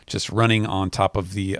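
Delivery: ordinary talk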